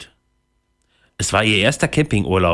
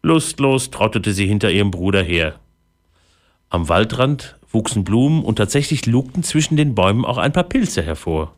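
ordinary delivery, nothing marked

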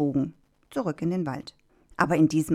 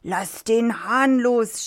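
high, drawn-out acted shout; 6 semitones higher, 3 dB louder, much slower than usual. A narrator's reading. Farmer chasing the fox orders him to release, shouting. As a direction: shout it slowly after the runaway. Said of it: "schrie der Bauer".

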